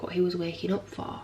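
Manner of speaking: ordinary talk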